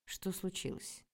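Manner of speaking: scared, soft question; frightened. A narrator's reading. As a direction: ask in sudden alarm. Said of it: "испугался Петя". (испуганно)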